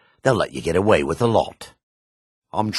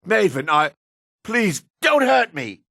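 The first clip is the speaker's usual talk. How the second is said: frightened pleading; 13 semitones higher than usual. Acted. Cornered shopkeeper begging Maven for mercy, frightened high plea. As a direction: plead high and scared, stretching the words.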